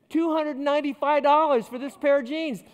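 shocked at the price. Retold or imitated. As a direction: blurt out the price in disbelief. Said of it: "I nearly fainted".

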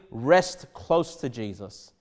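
usual speaking voice

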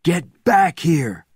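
high, loud, stretched-out yell; forceful commanding shout; shouted order. A narrator's reading. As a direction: yell it slowly to call someone back. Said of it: "shouted Bourne".

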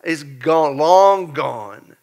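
ordinary delivery

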